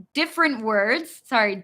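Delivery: normal talking voice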